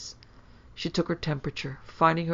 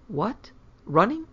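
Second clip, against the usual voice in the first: high, startled question; suddenly alarmed mother; 6 semitones higher, 4 dB louder, slower than usual. A narrator's reading.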